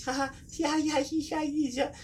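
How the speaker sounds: very high, giggly squeal; imitated gleeful cackling. Kids hiding in the truck bed, high mock giggling. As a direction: mimic kids tittering in hiding.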